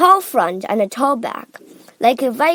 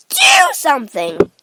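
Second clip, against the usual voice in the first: loud, raised demand; Missus Gloop; 4 semitones higher, 7 dB louder than usual. A narrator's reading. Mother demanding her husband act, screamed. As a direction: demand action at full voice. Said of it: "Missus Gloop screamed at Mister Gloop".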